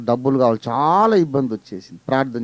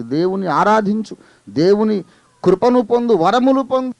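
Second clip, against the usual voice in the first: building, higher and louder exhortation; rising preaching; 8 semitones higher, 5 dB louder than usual. Acted. Preacher exhorts believers to worship, building to loud and high. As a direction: urge them on with growing force.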